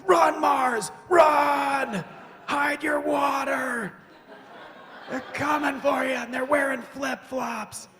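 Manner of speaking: high, loud panicked shout; Earth screaming. Acted. Earth warning Mars, high panicked shout. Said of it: "Earth is just screaming at Mars".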